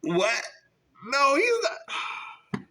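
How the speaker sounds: high, drawn-out stunned no; shocked disbelief. Retold or imitated. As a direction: gasp it out in shock. Shocked at candidate truth.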